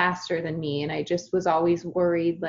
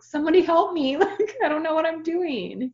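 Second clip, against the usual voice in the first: mock cry for help; 10 semitones higher, 4 dB louder than usual. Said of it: "I was like".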